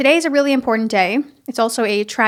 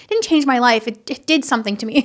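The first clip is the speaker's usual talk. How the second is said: normal talking voice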